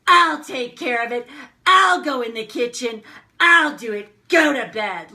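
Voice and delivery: loud, high re-enacted outburst; barking back. Triggered wife to husband after being woken, re-enacted angry barking. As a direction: snap back at full volume, each sentence hit hard. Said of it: "I am barking back at him".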